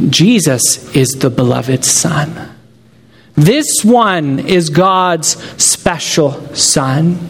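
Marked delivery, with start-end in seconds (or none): none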